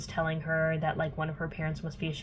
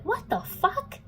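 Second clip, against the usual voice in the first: high-pitched mock shock; shocked disbelief; 13 semitones higher, slower than usual. Simon catching Clary and Jace kissing, mock shock. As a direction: imitate someone aghast at what they see.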